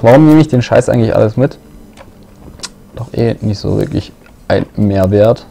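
ordinary talk

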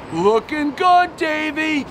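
slow high construction-site come-on; catcalling construction worker. Retold or imitated. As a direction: stretch it out like a guy leering from a building site.

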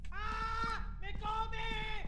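terrified squeal. Real, not acted. Monster grabs him.

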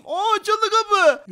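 loud, high, drawn-out exclamation; delighted surprise. Pretend. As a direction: exclaim slowly as it opens. Door opens at last. (sevinçli şaşkınlık)

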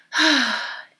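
audible breathy sigh; weary sigh. A narrator's reading. Grandma faced with Grandpa's old cardigan, sighing. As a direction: let out a long sigh. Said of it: "Grandma sighed".

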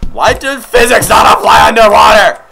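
high, loud outraged complaint; exasperated shout. Real, not acted. Player railing at underwater game physics, exasperated shout. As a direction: yell in disbelief at the nonsense.

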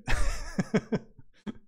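loud, high burst of laughter; real laugh. Real, not acted. Streamer after greeting a viewer, loud happy laugh. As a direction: laugh out loud in a quick rattle.

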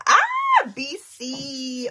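squealing BC shout-out; excited shout. Real, not acted. Greeting a viewer's province with a happy shriek. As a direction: shriek the province name with delight.

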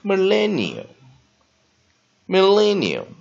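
millennial spelled out slowly, syllable by syllable, stress on len; very slow teacher demo of millennial; slow model pronunciation. Shown as a demonstration. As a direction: stretch every syllable of millennial for students. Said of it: "用自然音标的方式来解释两个单词".

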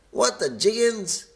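high-pitched shocked exclamation; high, startled storybook voice; astonished gasp. A narrator's reading. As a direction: cry out in astonishment like little creatures. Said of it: "gasped all the little bees".

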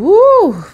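high loud woo; woo exclamation. Real, not acted. Recalling anxiety.

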